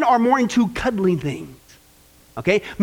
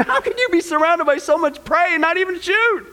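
exasperated disbelief; 7 semitones higher than usual. Acted. Men baffled by browsing wives.

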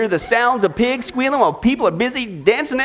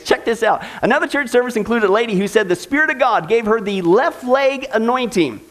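normal talking voice

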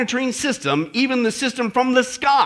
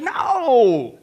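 emphatic denial; 2 semitones higher, 3 dB louder than usual. Acted. Paul's emphatic correction, acted.

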